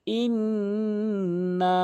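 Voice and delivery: ইন্না chanted with the vowel stretched very long; long drawn madd. Shown as a demonstration. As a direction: hold the madd on ইন্না for three alif. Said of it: "তিন আলিফ করে টেনে টেনে পড়বেন". (টেনে টেনে তেলাওয়াত)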